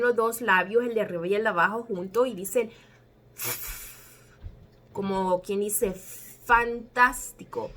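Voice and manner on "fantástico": "fantástico" with a long, drawn-out f; F inicial alargada. Shown as a demonstration. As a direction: stretch the first sound of "fantástico", slowly. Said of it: "pero solamente decimos". ("fantástico" con la efe inicial alargada)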